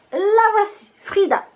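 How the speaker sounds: high, loud flourish, then falling; triumphant reveal. Real, not acted. Vlogger finally unveiling the taped-up palette, triumphant.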